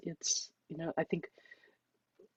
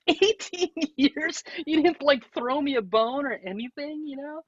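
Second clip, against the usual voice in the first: incredulous; 11 semitones higher, 4 dB louder than usual. Retold or imitated. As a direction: stretch out eighteen years in disbelief. Incredulous reply to mom.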